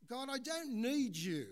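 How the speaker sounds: loud, high, proud refusal; voice of pride. Acted. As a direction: push God away with pride. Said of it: "don't let pride say".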